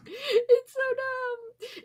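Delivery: high, slow, laughing whine. Real, not acted. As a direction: squeal it out laughing. Laughing at the flarp.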